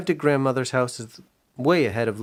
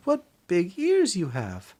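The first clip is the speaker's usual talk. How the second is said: put-on high, slow wondering girl; little girl voice; 7 semitones higher, slower than usual. Minnie noticing the big ears, high slow wonder. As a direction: marvel slowly at granny's ears.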